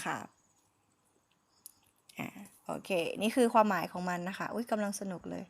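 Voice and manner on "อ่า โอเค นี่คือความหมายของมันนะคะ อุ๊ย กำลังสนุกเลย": normal talking voice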